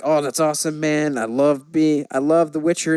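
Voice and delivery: usual speaking voice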